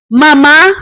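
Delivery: Mama called out loud in surprise; startled, call her name. Acted. Son startled, calling out to his mother loudly.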